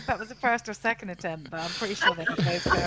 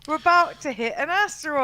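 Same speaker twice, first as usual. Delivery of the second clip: higher, louder mock alarm line; dramatic warning demo; 7 semitones higher, 6 dB louder than usual. Shown as a demonstration. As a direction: show how the captain should say it. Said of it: "You'll have to say something like".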